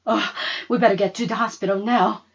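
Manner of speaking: urgent. Acted. Mama sees broken wrist.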